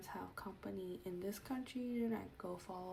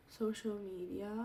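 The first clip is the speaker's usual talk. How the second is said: ordinary delivery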